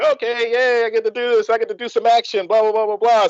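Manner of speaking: happy gushing running into blahs. Retold or imitated. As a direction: act out being happily on board. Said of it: "So I'm all, like, happy".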